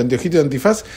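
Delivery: normal talking voice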